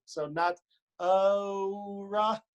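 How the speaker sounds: ora with a drawn ou glide, very slow; Texan diphthong ora. Shown as a demonstration. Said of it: "let everyone know if you're from Texas".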